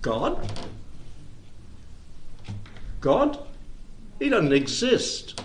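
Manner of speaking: scoffing disbelief, pitch leaping on the second God; a lightly voiced scoffer; mocking world. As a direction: play the world sneering at God. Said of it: "And the world says".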